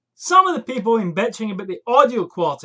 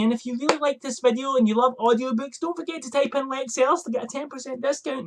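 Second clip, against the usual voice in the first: higher-pitched mock YouTuber ad read, sweetly salesy; mock sponsor plug; 6 semitones higher than usual. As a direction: parody a cheesy sponsor outro. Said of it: "I'm not sitting at the end going".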